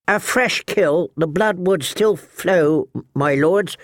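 put-on high, halting, nervous boy. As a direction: stammer it out nervously. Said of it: "Sam's nervous, high-pitched voice".

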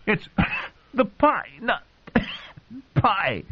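high and slow, words broken by coughs; coughing, choking. Acted. Joffrey insists through coughing to Margaery. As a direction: fight for air between words.

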